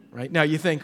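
normal talking voice

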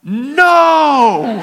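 long, high, loud comic refusal; yelling back. Acted. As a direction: holler it down the line. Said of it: "And I yell back".

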